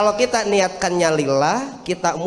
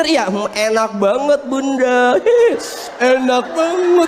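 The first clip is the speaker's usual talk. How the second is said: high, sugary fake praise; 3 semitones higher than usual. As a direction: gush over the food, lying. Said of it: "bohong aja bener".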